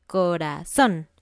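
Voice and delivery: "corazón" slow, last syllable loudest; stress on final syllable. Shown as a demonstration. Teacher showing an example word, stress on the end of "corazón". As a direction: draw out "corazón", punching the -zón. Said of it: "la parte fuerte al final de la palabra".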